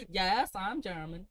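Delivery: slow, badly faked German accent; attempted German accent. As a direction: drag it out in a makeshift German accent. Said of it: "how to do a German accent".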